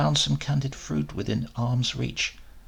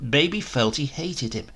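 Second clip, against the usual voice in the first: ordinary delivery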